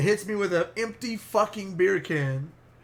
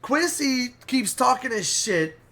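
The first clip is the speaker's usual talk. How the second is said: ordinary talk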